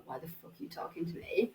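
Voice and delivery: whispered mock disdain; hushed disbelief. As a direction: whisper it like a silent, blank-faced rebuff. Voicing blank faces' silent reaction.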